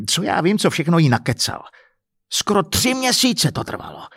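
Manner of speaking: high, indignant throw-away remark; exasperated rant. Acted. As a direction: vent it in angry frustration. Husband's rant continues.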